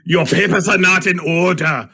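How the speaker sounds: loud, high mock German accent; hammed-up German official's accent. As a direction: bark it like a German inspector. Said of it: "Does he say it with a German accent?".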